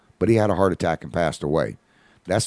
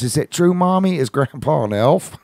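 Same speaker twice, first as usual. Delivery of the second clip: put-on higher, louder little-girl voice full of hope; imitating a little girl; 8 semitones higher, 4 dB louder than usual. Little girl asking mommy if grandpa is an elf, eager child voice. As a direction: lift into a small child's eager question. Four-year-old asks about grandpa.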